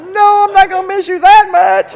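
loud, high comic blow-up; yelled retort. Retold or imitated. Wife snaps back at her dying husband, yelled retort. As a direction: holler the punchline at full volume.

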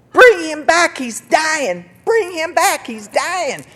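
high, loud reenacted scream. Wife to the buddies taking her sick husband, screaming. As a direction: scream after them as they drive away. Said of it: "I'm sitting out there screaming".

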